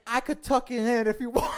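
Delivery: shouted high and loud through a laugh; loud laughing call to the women at the bar; laughing yell. Retold or imitated. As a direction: yell the cheeky offer across the bar. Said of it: "And I yelled it out".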